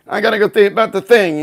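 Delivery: mock Goodfellas gangster; mafia line. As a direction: play the wise guy mumbling about business. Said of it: "he's doing the whole mafia, uh, line".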